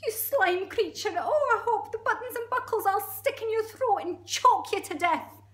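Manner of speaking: high, loud, squealing curse; outraged monkey. Acted. Monkey wishing the crocodile choked, high outraged squeal. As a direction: let fly in a shrieky monkey voice.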